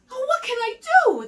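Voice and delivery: fast, high desperate cry; frantic sigh. A narrator's reading. Sheep chased by wolf, lion and snake cries out fast and high. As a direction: panic as the chase closes in. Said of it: "the little sheep sighed".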